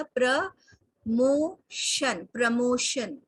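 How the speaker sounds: प्रमोशन drawn out very slowly as "pruh-MO-shun"; very slow प्रमोशन with a weak first vowel; slow pronunciation demo. Shown as a demonstration. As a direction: say प्रमोशन slowly so students hear each sound. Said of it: "तो वो कैसा हो जाएगा?".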